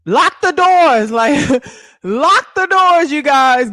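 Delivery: high, loud, drawn-out rallying call; playful loud order. Pretend. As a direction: holler it out with a laugh in the middle. Calling out to rally viewers.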